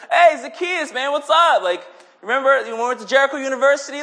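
high, loud hail to a long-lost buddy; mock old-pal greeting. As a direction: call out like greeting a college friend. Acted old-pal greeting, calling out.

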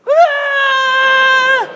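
high yelling wail of dismay; mock wail. Kid howling at his parents over a bad gift. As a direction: cry out like a cheated kid. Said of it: "and you go".

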